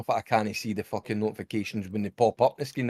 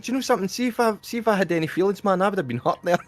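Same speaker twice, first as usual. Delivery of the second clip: laughing retelling; 8 semitones higher, faster than usual. Retold or imitated. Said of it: "I said".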